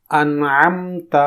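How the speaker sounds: انعمت slowly with a clear ع; teaching انعمت; slow careful انعمت. Shown as a demonstration. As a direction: stretch انعمت. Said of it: "اسے سنیے اور اس کے مطابق مشق".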